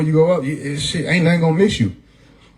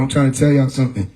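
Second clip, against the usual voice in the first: normal talking voice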